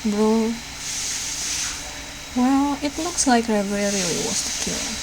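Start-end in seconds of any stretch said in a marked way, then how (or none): none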